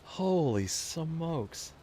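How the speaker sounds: startled, drawn-out exclamation; real startle, stretched out. Real, not acted. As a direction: let it out slowly after a scare. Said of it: "That just startled me".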